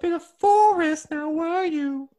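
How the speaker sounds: high falsetto British matron; mock old-lady falsetto; Missus Doubtfire. Teasing companion about fear of the forest, high matronly falsetto. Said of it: "[name], put Missus Doubtfire away".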